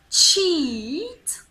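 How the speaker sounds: CHEAT loud and high with a long smiling EE. Shown as a demonstration. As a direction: stretch the EE in CHEAT, lips in a smile. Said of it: "Look at my lovely smile again".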